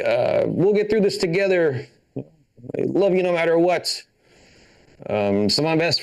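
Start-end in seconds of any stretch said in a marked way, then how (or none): 0.0-4.0 mock supportive. Supportive dad hug retold.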